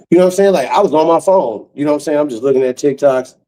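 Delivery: ordinary talk, nothing marked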